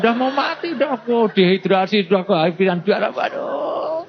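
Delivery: high, hammed-up victim's wail; mock dying panic. Dying victim begging past pointless questions, high mock-panicked wail. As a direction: protest in comic desperation, pitch soaring at the end.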